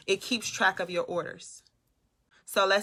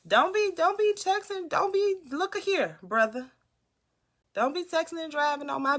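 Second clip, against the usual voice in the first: much higher, stammered warning; high-pitched scolding; 8 semitones higher than usual. Real, not acted. Streamer telling a viewer not to text, high-pitched scolding. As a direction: plead in a high voice, restarting. Pleads with viewer texting while driving.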